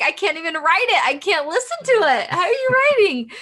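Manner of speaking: playful can't-cope complaint; mock exasperated. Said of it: "I'm like".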